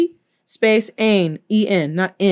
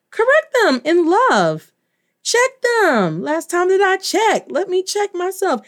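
high, sing-song mock admonishment; hammed-up sassy correction; playful scolding; 9 semitones higher than usual. Mock scolding of Spanish teachers.

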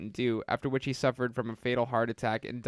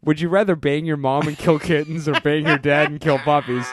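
louder, deadpan absurd question; mock earnest; 3 semitones higher, 7 dB louder than usual. Prank caller to attendance lady, loud deadpan question. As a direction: ask it straight as if it matters.

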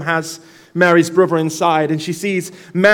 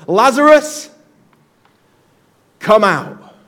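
loud, ringing call of Lazarus; Jesus summoning Lazarus from the grave, commanding call; 3 semitones higher, 4 dB louder, slower than usual. Acted. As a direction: call him out of the tomb with authority. Jesus calls the dead man out.

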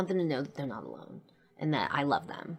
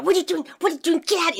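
high, loud acted-out grandma scolding; frantic scolding; 8 dB louder, much faster than usual. Grandma orders grandchildren out of class, frantic scolding. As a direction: scold urgently, pitched way up.

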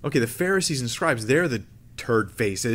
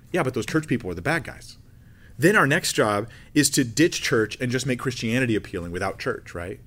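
normal talking voice

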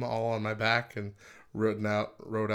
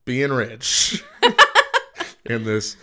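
laughing; 4 semitones higher, 7 dB louder, slower than usual. Real, not acted. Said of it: "So, Matt".

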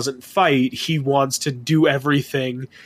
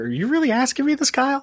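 high, fast incredulous squeal; mock outrage; 11 semitones higher, faster than usual. Real, not acted. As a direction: act scandalized by the question.

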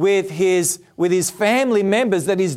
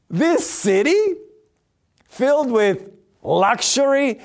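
put-on high, scoffing disbelief at the old man; mocking sons-in-law; 7 semitones higher than usual. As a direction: laugh off the warning as a joke. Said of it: "And the word there is mock".

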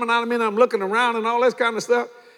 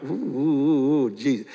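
mock pain moans; 7 semitones lower than usual. Brother mimics tube-pulling pain.

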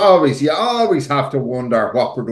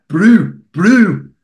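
high, loud mock South African twang; exaggerated whiny South African accent; 6 semitones higher, 6 dB louder than usual. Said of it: "that fucking whiny South African accent".

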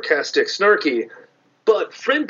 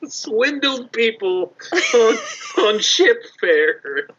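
high, slow, words broken by laughter; cracking up; 8 semitones higher, slower than usual. Real, not acted. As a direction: struggle to get it out through giggles. Cracking up at own metaphor.

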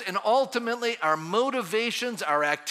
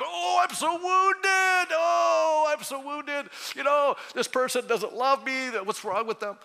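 high, slow, wailing mock lament; self-pitying whine; 7 semitones higher, slower than usual. Pastor mocking the congregation's self-pity, high whiny wail. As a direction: ham up the wounded martyr.

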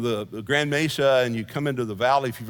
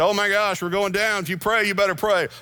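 mock panic; 5 semitones higher than usual. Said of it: "if the pilot would have said".

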